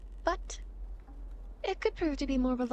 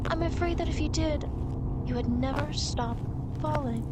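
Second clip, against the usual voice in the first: ordinary talk, nothing marked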